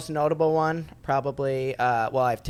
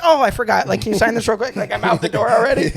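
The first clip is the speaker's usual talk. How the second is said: put-on rushed kid voice; 8 semitones higher, 8 dB louder than usual. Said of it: "And I'd be like".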